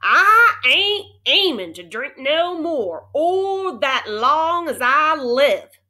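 slow, loud, high Texas cowboy voice; Texas cowboy rant. A narrator's reading. Cowboy refuses the water, loud Texas drawl. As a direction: drag out each word in Texas twang.

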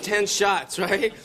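usual speaking voice

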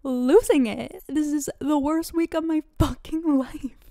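high, loud hammed-up despair; mock meltdown. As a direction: wail it as if coming undone, half joking. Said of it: "I can't call and be like".

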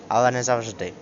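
ordinary talk, nothing marked

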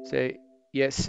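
usual speaking voice

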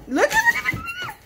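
very high excited "look at"; thrilled high squeal to the viewers. Real, not acted. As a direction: squeal with delight at the dog. Excited at dog.

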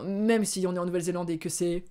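ordinary delivery

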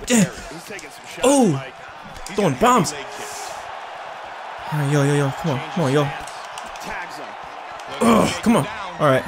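usual speaking voice

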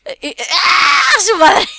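real panicked scream while falling. Real, not acted. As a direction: yell in panic as you slip. Falling off the parkour.

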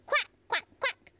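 short, high quacks; spaced-out duck calls. Shown as a demonstration. Host repeats the choices for next player, short duck quacks. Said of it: "Which of these sounds goes".

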